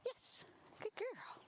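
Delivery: high, lilting praise; high dog praise. Real, not acted. Trainer praising the dog, high and lilting. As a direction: lift it up.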